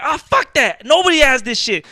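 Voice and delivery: loud, high mimicked fury; retold angry outburst. Retells customer's angry outburst.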